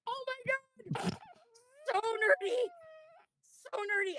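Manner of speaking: high, choked-up happy crying; overwhelmed fan about to cry; tearful joy. Real, not acted. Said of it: "You got some feelings about this".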